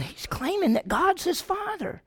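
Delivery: high, scandalised gasp of a claim; outraged accusation. Retold or imitated. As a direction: sound shocked that he'd dare. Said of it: "they're all going".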